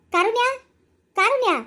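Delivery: loud, high shout of a name; calling out. Acted. Angry elder shouts for her granddaughter mid-quarrel, loud high call. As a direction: yell for her to come at once.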